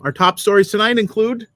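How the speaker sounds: booming anchorman opener; news anchor voice. Pretend. Mock news intro.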